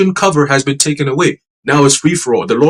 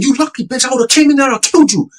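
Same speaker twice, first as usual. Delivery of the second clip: imitated crazed threat; 10 semitones higher than usual. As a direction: imitate a man going crazy with threats. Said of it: "Like, going crazy".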